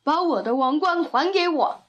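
lightly voiced demanding elephant; a hint of an indignant elephant; elephant character. A narrator's reading. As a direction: demand it back as a storybook elephant. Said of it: "大象说".

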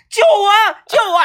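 high, loud hammed-up shout for help; mock cry for help. Pretend novice shouting back to the instructor, mock yell. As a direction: yell back for rescue, over the top. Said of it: "你还回头喊".

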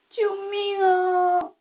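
slow high wail for help; crying shout. A narrator's reading. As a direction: call out with a sob in the voice. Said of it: "喊声中带着哭腔". (带着哭腔拖长呼救)

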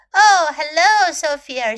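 high, loud, drawn-out hello; storybook dialogue, loud and lifted; character's greeting. A narrator's reading. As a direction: greet a friend at the door, slowly. Story character greets friend at door.

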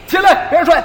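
loud, high furious yell; angry shout. Retold or imitated. Master punching apprentice awake, furious yell. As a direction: roar at him to get up.

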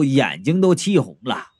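ordinary delivery, nothing marked

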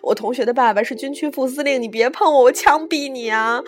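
loud, high hysterical tirade; wailing yell. A narrator's reading. Terrified jumper fighting off the coach, wailing and yelling. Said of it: "何木子哇的哭了，喊".

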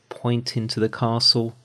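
plain reading voice

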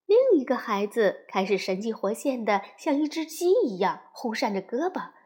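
ordinary delivery, nothing marked

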